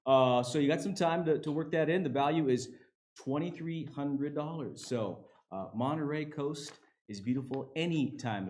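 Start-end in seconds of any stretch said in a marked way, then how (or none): none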